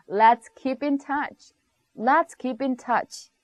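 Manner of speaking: spoken slowly, clearly; slow and clear for learners to copy; slow model phrase. Shown as a demonstration. Teacher modelling a phrase slowly for the class. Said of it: "이렇게 쓰시면".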